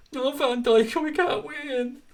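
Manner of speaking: mock crying. Streamer mocking a fan crying about Van Dijk, mock whine. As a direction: whimper it like a sulking child. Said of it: "that lad crying about Van Dijk".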